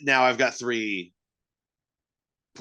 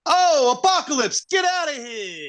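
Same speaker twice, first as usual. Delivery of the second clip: higher, hammed-up New York Italian guy; mock Italian voice; 5 dB louder than usual. Podcast hosts riff on Italian superheroes, mock wiseguy dismissal. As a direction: shoo him off, wiseguy style.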